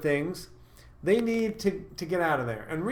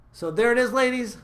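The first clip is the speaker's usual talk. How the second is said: higher, bouncy YouTuber outro; rally the fans to like and subscribe; cheerful host wrapping up the video; upbeat sign-off; 5 semitones higher than usual. Acted.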